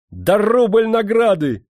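high, loud, drawn-out cry; merry shouting. A narrator's reading. New general granting the messenger a reward, merry shouting. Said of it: "весело закричал он".

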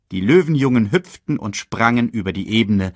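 ordinary delivery, nothing marked

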